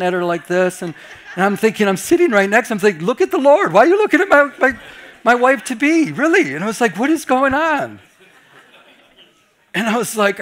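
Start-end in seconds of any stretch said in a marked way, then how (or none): 3.0-6.5 high, loud, indignant disbelief; half-joking jealous rant, raised and pitched up; exasperated outburst. Retold or imitated. Jealous fiancé's inner outburst. 7.0-8.0 slow, high, baffled; retold astonishment, pitched up; incredulous. Jealous inner reaction.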